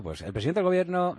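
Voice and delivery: mocking the singing cadence of journalists; sing-song newsreader tune. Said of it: "a cantar al final".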